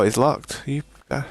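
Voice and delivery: usual speaking voice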